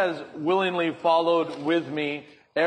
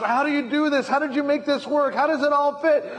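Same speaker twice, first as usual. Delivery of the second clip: mock panic; 8 semitones higher, 4 dB louder than usual. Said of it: "and you're like".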